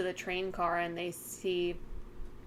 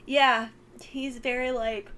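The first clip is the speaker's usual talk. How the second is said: words stretched by a yawn; 5 semitones higher, 6 dB louder, slower than usual. Real, not acted. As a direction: talk through a yawn. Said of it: "Sorry, I keep yawning".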